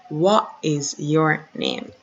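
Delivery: normal talking voice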